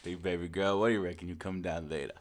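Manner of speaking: lightly voiced low frat boy; a hint of a sleazy bro. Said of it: "TikToker fuckboy laugh".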